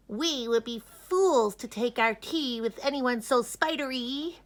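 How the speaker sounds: a snooty bee turning her down. A narrator's reading. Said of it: "one bee spoke".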